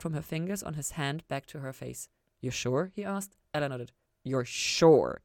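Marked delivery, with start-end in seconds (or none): 4.2-5.2 louder, grave wary check. A narrator's reading. Lucifer checking Ella wants to see his wings, wary. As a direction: make her really think about it. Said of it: "warily enough that Ella paused".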